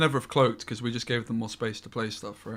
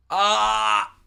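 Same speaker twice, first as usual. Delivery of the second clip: high loud anguished wail; frustrated cry; 10 semitones higher, 8 dB louder than usual. Real, not acted. Frustrated after misplay.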